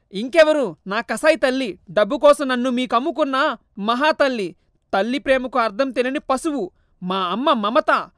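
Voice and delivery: angry son, slowing on the last words; acted fury at his birth mother; bitter anger. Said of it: "కోపంగా".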